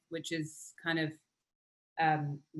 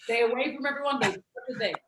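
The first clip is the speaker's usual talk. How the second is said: higher, louder mock warning voice; mock safety order; 6 semitones higher, 4 dB louder than usual. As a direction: parrot the set's safety rules. Mimicking safety instructions.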